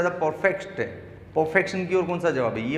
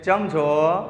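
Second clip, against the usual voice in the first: drawn-out Gujarati dialect greeting; 3 dB louder, much slower than usual. Shown as a demonstration. Teacher imitating how some Gujaratis greet, dialect imitation. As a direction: imitate a regional Gujarati way of speaking. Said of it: "कुछ लोग बोलते हैं".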